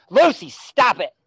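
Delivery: high loud call away from the mic; sharp shout at the dog. Real, not acted. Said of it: "Sorry, that was my dog".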